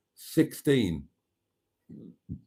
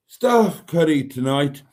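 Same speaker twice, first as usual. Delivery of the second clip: usual speaking voice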